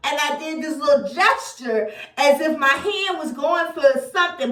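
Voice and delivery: ordinary talk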